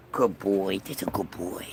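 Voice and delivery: low, soft coaxing to the dog; soft pet talk. Real, not acted. Speaker softly praising the dog, low and soft.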